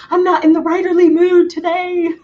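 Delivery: high, mock reluctant voice; mock whiny. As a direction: whine it like a lazy writer. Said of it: "And I saw it pop up and I'm like".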